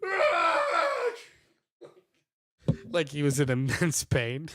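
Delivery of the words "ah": high strained yell, as if in agony; dad's pained grunt-yell. Retold or imitated. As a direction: imitate a dad's overdone groan. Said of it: "He would always go".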